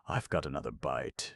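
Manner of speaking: ordinary delivery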